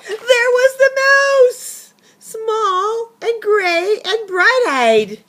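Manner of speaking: high, loud, slow astonished reveal; dramatic reveal. A narrator's reading. Narrator reveals the mouse in the kettle, high slow astonishment. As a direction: stretch out the shock.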